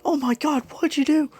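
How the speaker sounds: very high-pitched mock panic; freaking out. Whole class reacts to the smashed door, freaking out in a high voice. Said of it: "Class one A is freaking out".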